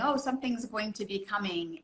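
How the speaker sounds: ordinary delivery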